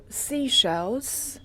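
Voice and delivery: ordinary talk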